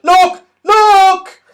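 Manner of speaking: very high, loud cry of delight; excited shout. Acted. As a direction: call everyone to look, thrilled. Pixie shouting at fireworks.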